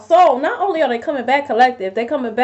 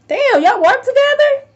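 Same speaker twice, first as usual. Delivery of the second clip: amazed; 12 semitones higher, 3 dB louder than usual. Real, not acted. Reacting to cards about co-workers.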